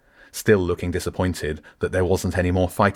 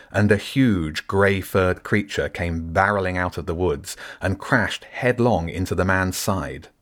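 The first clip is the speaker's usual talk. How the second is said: ordinary delivery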